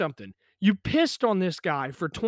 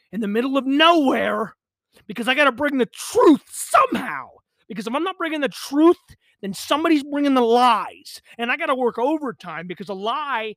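ranting; 6 semitones higher, 6 dB louder than usual. Real, not acted. Ranting about bringing the truth.

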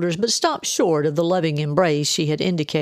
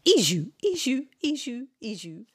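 high sing-song, fading away; repeating to memorise; 8 semitones higher, 4 dB quieter than usual. A narrator's reading. Aunt memorising a name while leaving, fading sing-song. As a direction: chant it high, dwindling softer as you leave. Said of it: "continued to repeat the name as she headed back".

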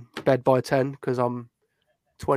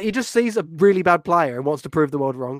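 ordinary talk, nothing marked

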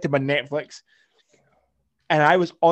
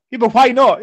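sharply higher, much louder challenge; high, loud mock girlfriend voice; indignant girl's question; 10 semitones higher, 12 dB louder than usual. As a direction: fire back why not like a puzzled girlfriend. Voicing the girl's demanding question.

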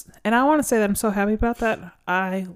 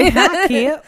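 high, twangy country hello; real country; 11 semitones higher, 7 dB louder than usual. Acted. Host greets co-host Kip in a country accent. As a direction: greet him in a thick country drawl. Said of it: "I said that real country".

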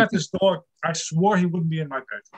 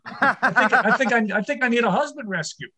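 words through a laugh, higher; 5 semitones higher than usual. Real, not acted. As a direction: chuckle while delivering the line.

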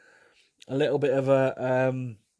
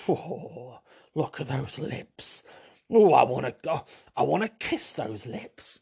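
mock swoon; slower than usual. Mock swooning man at pout.

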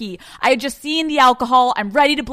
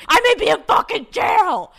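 panicked outburst; 12 semitones higher, 4 dB louder than usual. Retold or imitated. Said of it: "She's like".

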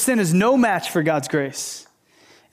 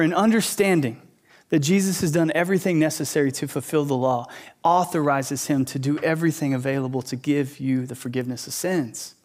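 ordinary talk, nothing marked

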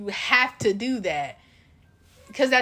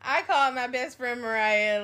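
usual speaking voice

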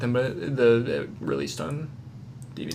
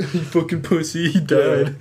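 laughing; 4 semitones higher, 4 dB louder than usual. Real, not acted. Joking about the challenge.

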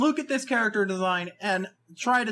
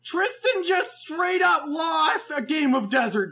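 incredulous rant; 4 semitones higher than usual. Real, not acted. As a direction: yell it like you can't believe it. Ranting about Tristan's driving.

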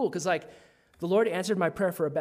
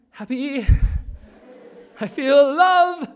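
giddy; 11 semitones higher, 13 dB louder, much slower than usual. Retold or imitated. Giddy confession of feelings.

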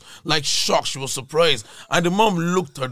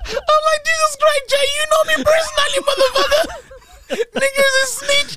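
hysterical laughing outburst. Real, not acted. Comedian protesting to his friend Jay, high and helpless with laughter. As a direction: shriek it out in real hysterics.

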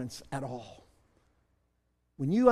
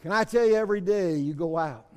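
ordinary talk, nothing marked

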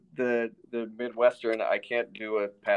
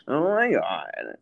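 higher, long-drawn Midwestern vowels; wholesome Midwest accent; 4 semitones higher, much slower than usual. Pretend. Co-host marvels at a strange soda, wholesome Midwest accent. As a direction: draw out a swooping Midwest exclamation. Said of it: "your wholesome Midwest accent".

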